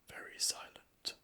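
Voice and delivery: breathy whisper on the word. A narrator's reading. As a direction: breathe it out softly. Narrator hushes for silent street.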